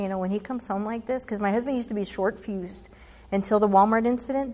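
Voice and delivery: ordinary talk, nothing marked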